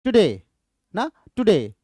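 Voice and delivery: "Today" with quick light टु, falling stressed डे; stress on DAY. Shown as a demonstration. Teacher repeats it for students, stress on second syllable of "today". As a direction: repeat "today" as one word, weight on डे. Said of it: "डे हमारा stressed है".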